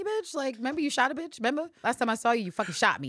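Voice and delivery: high, loud accusing rant; shrill accusation. Retold or imitated. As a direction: pile it on her, outraged. Constantine accusing Eve.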